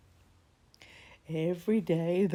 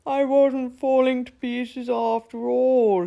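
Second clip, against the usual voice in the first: higher, much louder, slow shout of joy; joyful shout; 7 semitones higher, 10 dB louder, slower than usual. Acted. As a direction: cheer it out loud. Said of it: "he shouted".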